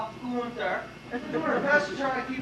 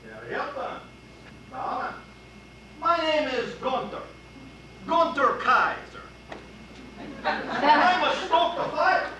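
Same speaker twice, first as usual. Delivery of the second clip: put-on German accent. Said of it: "it has a German accent".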